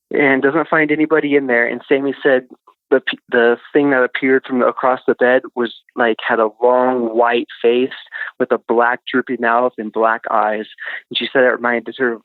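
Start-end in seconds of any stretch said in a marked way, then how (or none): none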